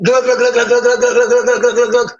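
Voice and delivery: rapid rhythmic glugging noise; mouth-made chugging sound; imitated gulping. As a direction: mimic greedily chugging water, glug after glug.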